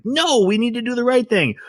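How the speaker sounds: high-pitched mock argument; mock yelling. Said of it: "yelling at each other".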